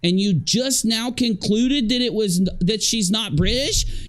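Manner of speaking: can't believe she only just figured it out; exasperated disbelief. Real, not acted. Mocking co-host's late realization.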